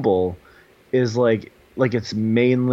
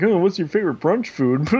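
mock chat voice; 8 semitones higher than usual. Mock flirty opener in dating chat.

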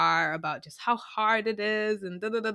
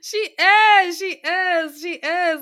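emphatic agreement; 8 semitones higher, 8 dB louder, slower than usual. Real, not acted.

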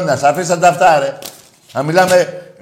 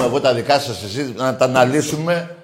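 ordinary delivery

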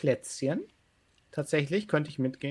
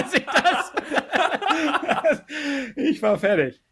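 laughing; 11 semitones higher than usual. Real, not acted. Laughs at own long confusion.

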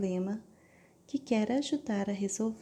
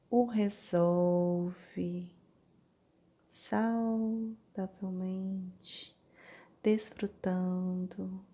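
slow, syllable by syllable; over enunciating; much slower than usual. Shown as a demonstration. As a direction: stretch every syllable out slowly. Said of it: "lentamente, sílaba por sílaba". (pronunciando sílaba por sílaba)

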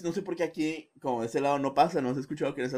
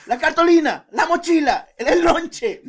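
higher, louder nagging mom listing chores; nagging mother voice; 13 semitones higher, 8 dB louder than usual. Retold or imitated. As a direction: rattle off orders like a frantic mother. Mimicking mother's morning orders. (imitando a la vecina, que le grita a Joel las tareas de la mañana con voz aguda y fuerte)

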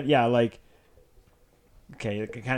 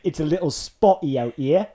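louder mock British, rising at the end; British accent; 2 semitones higher, 5 dB louder than usual. Shown as a demonstration. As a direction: throw yourself into British. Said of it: "best British accent".

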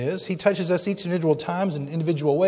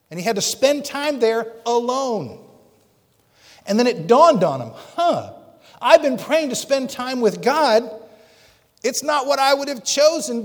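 fervent pulpit climax; preaching at full force; 8 semitones higher than usual. Acted. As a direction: lift the congregation, voice soaring.

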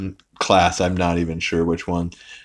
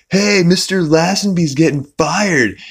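put-on surfer girl; 10 semitones higher than usual. Connie running in with news, high surfer-girl voice. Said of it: "she talks like that because she's a surfer".

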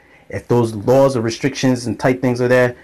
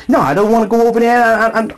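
high, fast flustered refusal; mom refusing; 9 semitones higher than usual. Retold or imitated. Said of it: "she goes".

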